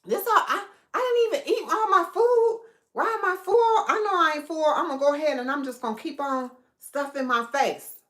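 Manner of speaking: high, puzzled mock voice; mock bewildered squeal; confused self-talk. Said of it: "you'll be sitting up here going".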